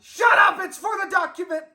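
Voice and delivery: loud and high, fed up; angry yell. Acted. To Spanky, who ruined his take, furious yell. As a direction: blow up at the interrupter.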